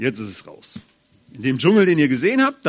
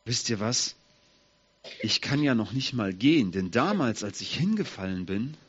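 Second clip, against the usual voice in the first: usual speaking voice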